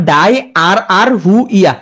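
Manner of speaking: chunked "ডাই আর আর হু ইয়া", each piece rising; spelling pronunciation. Shown as a demonstration. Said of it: "উচ্চারণ করবা".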